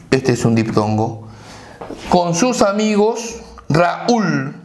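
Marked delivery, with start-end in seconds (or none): 3.7-4.6 "Raúl" broken into Ra and úl; syllable by syllable. Shown as a demonstration. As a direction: sound out "Raúl" as two separate syllables, Ra-úl. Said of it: "Separamos en sílabas". ("Raúl" separado en sílabas: Ra-úl)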